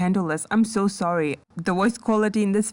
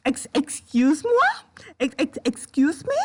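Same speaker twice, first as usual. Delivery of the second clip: higher, stuttering shock, rising at the end; stammering disbelief; 6 semitones higher than usual. Real, not acted. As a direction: stumble into an incredulous question.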